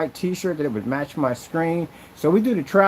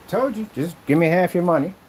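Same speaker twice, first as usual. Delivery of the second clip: normal talking voice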